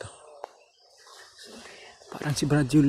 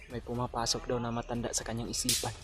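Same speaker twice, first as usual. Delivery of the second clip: whispering while hiding; 12 dB quieter than usual. Real, not acted. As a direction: speak softly so nobody hears. Hiding from enemies, quiet.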